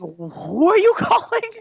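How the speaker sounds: high, drawn-out mimicked question; imitated quote. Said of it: "He said it so well".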